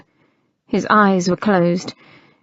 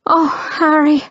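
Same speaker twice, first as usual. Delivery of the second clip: sighed; 6 semitones higher than usual. A narrator's reading. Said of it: "his lady sighed".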